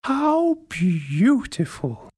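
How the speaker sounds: slow, high delighted granny gasp; old lady exclaiming. A narrator's reading. Old lady admires the finished cardigan, slow high delighted exclaim. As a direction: draw it out in high wonder. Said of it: "the old lady exclaimed".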